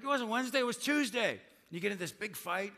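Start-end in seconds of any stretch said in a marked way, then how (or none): 0.0-1.4 higher, mock defensive retort; answering the spouse with a mock retort. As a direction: snipe back in the fight. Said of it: "you're like".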